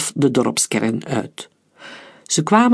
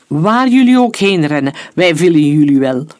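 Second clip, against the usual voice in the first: louder, gloating threat; menacing threat; 6 dB louder than usual. Acted. Leader to fleeing boys, loud menacing threat. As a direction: snarl it loud at the prey.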